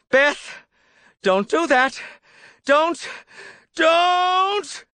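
very high, escalating cries; panicked pleading. A narrator's reading. Ed begging Beth not to crush the doll, panicked shriek.

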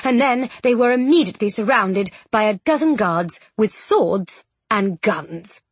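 usual speaking voice

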